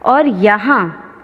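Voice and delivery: ordinary talk, nothing marked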